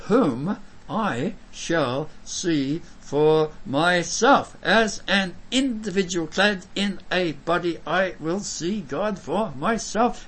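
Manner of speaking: slow, each word set apart; measured, deliberate proclamation; emphatic declamation. Acted. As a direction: spell it out word by word with conviction. Repeats verse to stress the individual.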